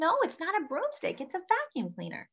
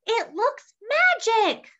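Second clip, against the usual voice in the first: excited; 12 semitones higher, 5 dB louder, slower than usual. Acted. Witch marvels at glowing vacuum.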